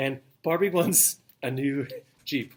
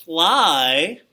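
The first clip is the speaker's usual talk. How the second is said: Piro voice; 3 semitones higher, 5 dB louder than usual. Retold or imitated. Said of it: "But Piro says".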